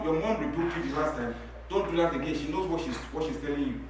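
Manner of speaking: normal talking voice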